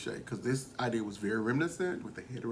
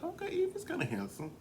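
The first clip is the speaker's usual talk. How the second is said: pitched way up, mock surprised; surprised realization; 4 dB quieter, faster than usual. Realizing Evie is handsome, pitched way up in surprise. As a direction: squeal it like a sudden discovery. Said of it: "now I'm like".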